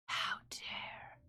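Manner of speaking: breathless mock-offended whisper; hushed mock outrage; whispered how dare. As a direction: hiss it under your breath in fake outrage. Reacting to wrong file.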